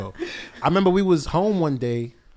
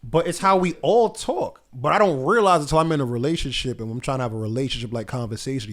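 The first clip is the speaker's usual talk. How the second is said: normal talking voice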